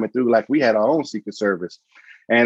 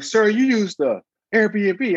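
mock clerk; 11 semitones higher, slower than usual. Mimicking the clerk's objection.

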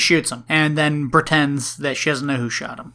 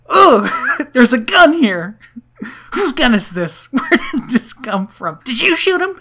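higher, louder, laughing through a frantic rant; half-laughing imitation of a panicked brother; hammed-up frantic yelling; 8 semitones higher, 8 dB louder than usual. Retelling the movie, brother yells at the cop, laughing mock panic. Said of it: "He goes".

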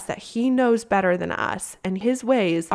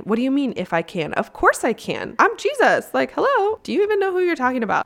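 rising to a much higher, fast, incredulous "hello"; sassy Jesus; 5 semitones higher, faster than usual. Retold or imitated. Jesus answering the doubting father, sassy and incredulous. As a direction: play Jesus as amused and a bit indignant. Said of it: "Jesus is kinda sassy".

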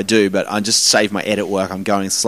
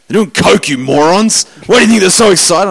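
1 exasperated scolding; 7 semitones higher, 5 dB louder than usual. Retold or imitated. Francis Blair scolding naive friends.

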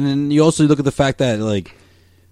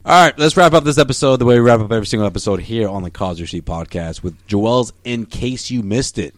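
ordinary talk, nothing marked

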